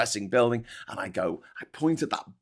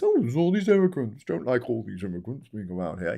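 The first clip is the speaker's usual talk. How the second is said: mock bigot. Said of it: "next time someone says".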